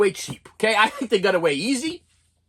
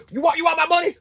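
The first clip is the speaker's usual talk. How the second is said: father flipping out; 8 semitones higher than usual. Retold or imitated. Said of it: "he started flipping out".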